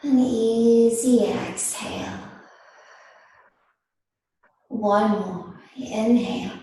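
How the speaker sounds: slow, unhurried yoga teacher voice with long pauses; guided meditation. Real, not acted. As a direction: ease them gently into stillness, phrase by phrase. Leads seated breathing meditation.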